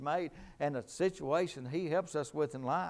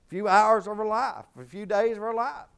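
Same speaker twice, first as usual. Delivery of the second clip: loud, high, driving preacher's cry; full-force preaching; 8 semitones higher, 11 dB louder than usual. Acted. Preacher rousing the congregation, shouting high and loud.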